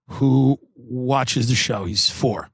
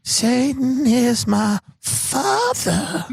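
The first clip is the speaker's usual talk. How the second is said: high, slow, eerie mock voice; 6 semitones higher, much slower than usual. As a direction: ham up a spooky pop star. Impersonating [name]'s dark lines.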